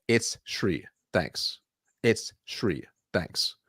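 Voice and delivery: "Thanks" dropped low and soft each time; intentional downward inflection. Shown as a demonstration. Coach repeats the closing line to the rep, falling. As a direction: end every phrase on a firm fall. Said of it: "Downward inflecting, intentional".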